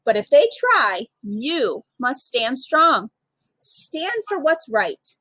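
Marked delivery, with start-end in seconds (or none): none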